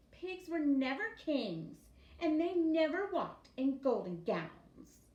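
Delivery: loud, high, slow shout; indignant sister shouting. Acted. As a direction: yell it at her brother. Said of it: "the little pig's sister shouted".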